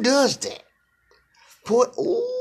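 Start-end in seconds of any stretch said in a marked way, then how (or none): 1.6-2.4 very high ooh; squeal. Real, not acted. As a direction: squeal in delight. Mocking her phrase.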